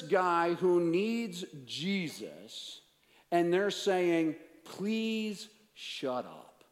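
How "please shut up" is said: mock plea, higher and drawn out; retold rebuke. Crowd silencing a man who needs Jesus, retold rebuke. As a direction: stretch it slowly as an imitated rebuke. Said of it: "It says they rebuked him".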